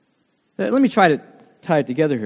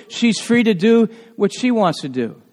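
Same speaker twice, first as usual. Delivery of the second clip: ordinary talk, nothing marked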